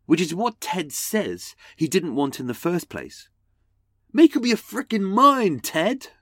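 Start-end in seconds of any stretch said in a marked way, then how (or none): 4.1-6.1 loud exasperated outburst. Real, not acted. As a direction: yell it in mock frustration. Exasperated at the letter writer.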